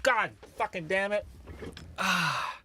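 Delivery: groaning in pain; cursing through toe pain; stubbed toe. Real, not acted. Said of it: "Stubbed my toe".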